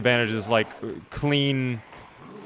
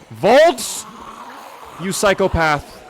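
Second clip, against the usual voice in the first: calling out; 5 semitones higher, much slower than usual. Real, not acted. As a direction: yell his name across the map. Calls out teammate.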